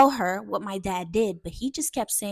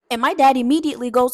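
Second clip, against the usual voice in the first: normal talking voice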